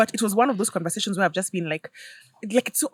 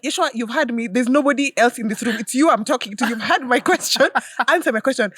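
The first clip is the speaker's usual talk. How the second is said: raised, emphatic mother's demand; mock exasperation; 5 semitones higher, 4 dB louder than usual. Mom cornering son who is stalling on her question, comic exasperation. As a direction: press him firmly for an answer.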